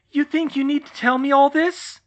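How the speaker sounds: high, loud scream. Acted. Hermione screaming at George. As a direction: hurl it at him. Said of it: "Hermione screamed".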